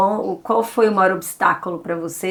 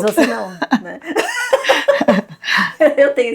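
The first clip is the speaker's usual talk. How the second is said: laughing; 3 semitones higher, faster than usual. Real, not acted. Mock mother's right to scold.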